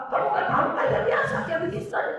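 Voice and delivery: high, loud half-laughing scold; mock scolding again. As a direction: snap at the child over the water. Father scolds the child again.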